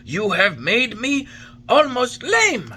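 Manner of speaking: put-on old man struck on his thin legs; hurt complaint. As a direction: protest the blow, half laughing bitterly. Geppetto hit by the wood.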